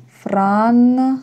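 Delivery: Франции spoken slowly and drawn out; slow careful model. Shown as a demonstration. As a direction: stretch Франции syllable by syllable.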